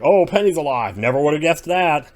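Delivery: sarcastic. Retold or imitated. Mocking a lame cliffhanger.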